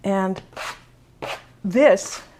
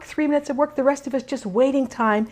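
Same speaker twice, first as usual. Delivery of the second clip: ordinary delivery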